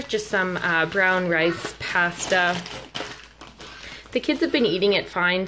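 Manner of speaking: ordinary talk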